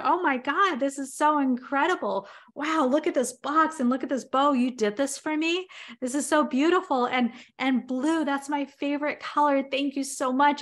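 high, delighted gushing over the present; husband thanking his wife, overjoyed; thrilled husband. Retold or imitated. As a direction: gush like a husband overjoyed by a gift. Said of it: "He's like".

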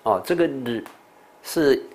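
normal talking voice

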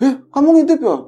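put-on high, loud scolding shout at a peeking kid. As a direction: bark it out, catching someone peeking. Pembina scolding the peeking narrator. (bentakan keras dan tinggi)

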